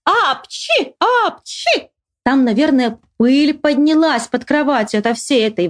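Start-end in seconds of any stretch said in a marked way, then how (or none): 0.0-1.9 high acted double sneeze; hearty sneeze. A narrator's reading. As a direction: sneeze with all your might, squeaky tail on it. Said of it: "чихну во всю Ивановскую, да ещё с хвостиком".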